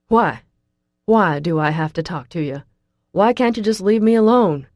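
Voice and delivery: loud, angry questions thrown at her. A narrator's reading. Russell angrily rejecting the girl, loud angry questions. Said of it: "Russell says angrily".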